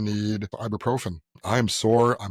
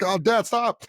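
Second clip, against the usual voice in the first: higher-pitched plea to dad; retold embarrassed protest; 10 semitones higher, slower than usual. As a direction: act out a son begging dad to stop.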